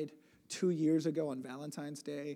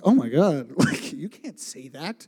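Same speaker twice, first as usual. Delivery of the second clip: loud, laughing disbelief; mock shock; 13 dB louder, slower than usual. Retelling his reply to the Russians, mock-shocked. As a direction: exclaim in scandalised amusement, breaking into laughter.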